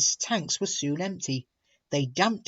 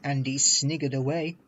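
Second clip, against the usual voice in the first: usual speaking voice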